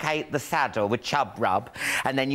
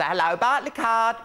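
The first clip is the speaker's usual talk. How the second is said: higher, drawn-out phone greeting; call-centre greeting; 6 semitones higher than usual. Retold or imitated. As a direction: imitate yourself answering the work phone slowly. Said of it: "you're talking about voices. When I used to answer".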